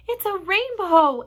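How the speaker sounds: slow, loud, high cry of wonder; drawn-out, thrilled announcement; amazed exclamation. A narrator's reading. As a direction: exclaim it in delight. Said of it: "everyone said at once".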